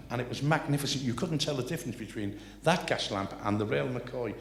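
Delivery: ordinary talk, nothing marked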